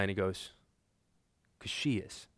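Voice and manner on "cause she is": hushed, deadpan punchline. Retold or imitated. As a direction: drop it quietly and matter-of-factly. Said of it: "looked back deadpan and goes".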